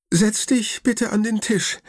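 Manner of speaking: high, a touch of tremble as the anxious grandma; trembling voice. A narrator's reading. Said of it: "mit zitternder Stimme". (hohe Oma-Stimme, leicht zitternd)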